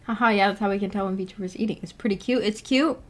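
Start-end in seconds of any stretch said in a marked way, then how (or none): none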